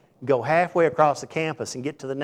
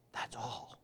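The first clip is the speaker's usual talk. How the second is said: whisper; 17 dB quieter than usual. Real, not acted. Aside to the congregation.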